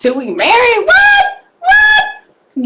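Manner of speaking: high, loud, crying-out disbelief; mock outraged disbelief. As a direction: squeal in scandalized shock at the rule. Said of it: "I'm like".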